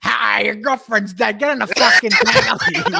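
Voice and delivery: blunt tough-guy impression, drawn out; John Burtnell. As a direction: deliver bad news slowly, then bark the order. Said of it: "and then John Burtnell goes".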